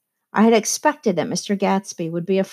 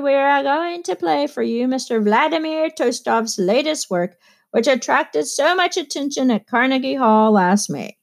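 higher, showman's announcing voice; orchestra leader's announcement; 6 semitones higher than usual. A narrator's reading. Bandleader announcing the new work to Gatsby's guests, higher and showy. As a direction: play the bandleader hyping the piece.

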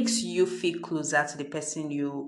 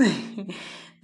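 short light laugh; amused chuckle; 3 semitones higher than usual. Real, not acted. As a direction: chuckle at your own aside. Amused by the crowing cock.

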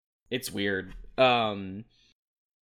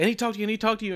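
higher, urgent mock plea; Mickey begging Trish to talk, hammed-up higher voice; mock pleading Mickey; 9 semitones higher, much faster than usual. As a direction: beg for her attention. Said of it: "And Mickey's like".

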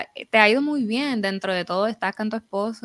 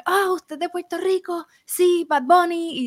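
mock foreigner; 10 semitones higher, 4 dB louder than usual. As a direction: ham up a clueless foreigner. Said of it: "Y es como que". (imitación burlona y aguda de un extranjero)

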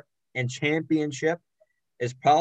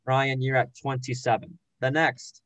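ordinary talk

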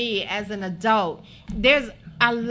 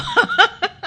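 a real burst of laughter, higher and louder; laughing at head spinning from it all; 10 semitones higher, 7 dB louder than usual. Real, not acted. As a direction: let a hearty laugh burst out of you. Host overwhelmed, bursts out laughing.

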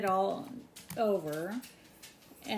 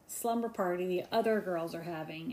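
ordinary talk

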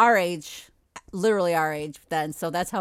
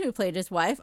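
ordinary delivery

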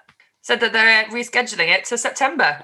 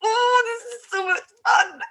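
high blissed-out sigh of a line; high contented festival daze; dreamy hungover bliss; 13 semitones higher than usual. Retold or imitated. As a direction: float it up high, lazy and content. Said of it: "you're just like".

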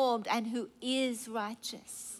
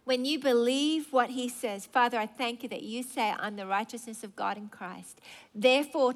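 usual speaking voice

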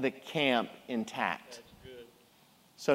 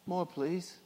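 much softer, meek begging; meek plea; 12 dB quieter than usual. Pretend. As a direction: beg timidly like a poor orphan.

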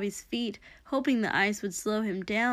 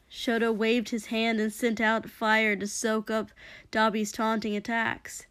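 ordinary delivery, nothing marked

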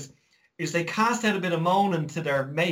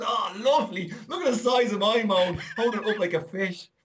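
higher-pitched mock delight at a catch; boastful angler; 6 semitones higher than usual. Fisherman showing mates the moan he caught, mock boastful. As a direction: show off your prize catch with playful glee.

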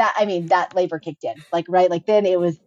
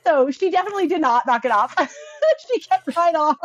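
laughing; 9 semitones higher than usual. Real, not acted. Laughing about mom.

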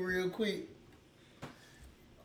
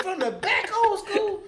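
high, loud, laughing incredulity; retold disbelief; 12 semitones higher, 7 dB louder than usual. Friend questioning old school's dirty claim, loud disbelief. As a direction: echo it back in amused shock. Retells his shocked question.

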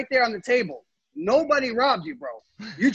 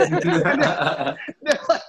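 laughing; 4 semitones lower, much slower than usual. Real, not acted. Laughing at his own joke.

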